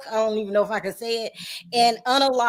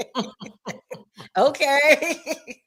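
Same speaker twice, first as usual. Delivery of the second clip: laughing okay; 2 semitones higher, much slower than usual. Real, not acted. Laughs at single remark.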